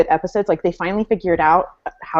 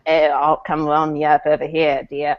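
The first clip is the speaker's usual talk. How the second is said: lower, badly faked British soldier; bad British accent; 4 semitones lower than usual. British soldier propositioning Claire at the bar, in a bad British accent. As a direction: attempt a British accent and fail. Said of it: "I'm terrible at doing a British accent".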